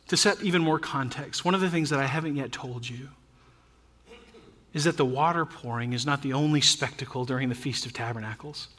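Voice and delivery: ordinary talk, nothing marked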